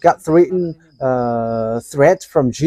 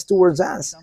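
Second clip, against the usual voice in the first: normal talking voice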